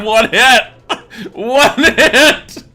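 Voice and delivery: high, loud shout through laughter; gleeful crowing. Real, not acted. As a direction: crow it triumphantly while cracking up. Crows over a one-hit kill.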